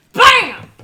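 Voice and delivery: sudden loud, high exclamation; celebratory shout. Real, not acted. Streamer celebrating a birthday resub, explosive shout. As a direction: punch it out as a huge high shout.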